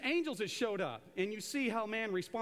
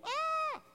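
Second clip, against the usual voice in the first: terrified cry. Retold or imitated. People's reaction when God appears.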